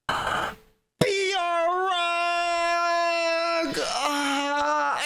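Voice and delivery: high, very drawn-out cry of the name; drunk joyous cry. Retold or imitated. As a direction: wail the name like a drunk reunion. Drunk friend greets, hugs.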